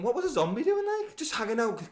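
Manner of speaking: high, incredulous mockery; mock disbelief. Real, not acted. Friend ranting about the show in high disbelief. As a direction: act baffled at the silly plot. Mocking the show's zombie logic.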